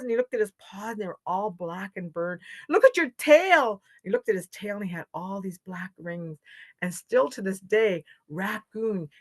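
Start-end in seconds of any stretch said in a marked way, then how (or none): 2.7-3.8 high, loud exclamation; alarmed. A narrator's reading. Animals show Raccoon the burnt tail, alarmed. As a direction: point it out in shock.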